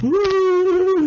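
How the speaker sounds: high-pitched imitated bawling wail; hammed-up sobbing wail; drawn-out comic cry, far above normal pitch; mock blubbering. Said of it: "I'm blubbering".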